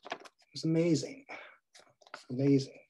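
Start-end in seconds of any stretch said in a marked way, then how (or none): none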